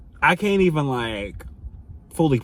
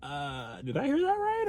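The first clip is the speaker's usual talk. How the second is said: stunned disbelief; 9 dB quieter, slower than usual. Retold or imitated. Inner disbelief at the confession.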